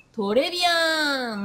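Japanese-style "トレビアン" with a vowel after T, drawn out; "トレビアン" as katakana, every syllable voiced and stretched; katakana pronunciation. Shown as a demonstration. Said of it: "カタカナで覚えてる方も多い".

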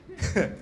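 a quick high giggle that falls away; high laugh. Shown as a demonstration. Teacher showing the group contagious laughter, high falling giggle. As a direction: toss off a bright, high little laugh.

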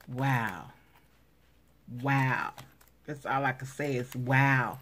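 ordinary delivery

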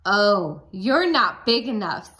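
loud grouchy chant; grouchy put-down. Acted. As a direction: say it big with the kids. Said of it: "Say this with me".

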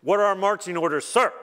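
soldier reporting. Pretend. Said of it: "lightning bolts line up in formation before God and say".

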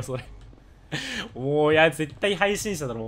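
ordinary talk

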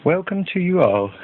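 a touch of a cheery hostess voice; camp leader's welcome. Acted. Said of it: "said Miss Stringfellow, the camp leader".

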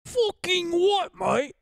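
slow, high mock British geezer; British accent. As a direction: drag it out in a Cockney voice. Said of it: "repeats the British phrase".

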